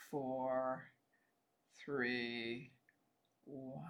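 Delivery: counting down, fading softly away; distant lullaby. Acted. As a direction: let each number drift off like a lullaby. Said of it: "let my voice become a distant lullaby".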